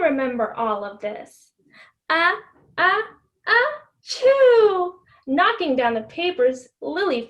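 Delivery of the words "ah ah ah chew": building ah-ah-ah into a loud choo; acted sneeze that builds and explodes; big sneeze. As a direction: wind up slowly then burst into a sneeze. Lily sneezes in story.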